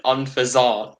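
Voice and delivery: Unfaisant with a nasal French ending; French accent. Shown as a demonstration. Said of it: "in a French accent".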